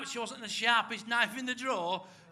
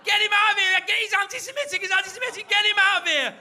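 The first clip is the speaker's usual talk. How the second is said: high, loud outraged yelling; screaming to get him thrown out; furious shout; 11 semitones higher, 11 dB louder than usual. Retold or imitated. Muriel on the quiz show shrieking at him, high and loud.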